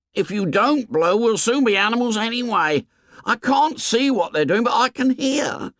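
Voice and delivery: Piggy voice. Acted. Piggy urging Ralph.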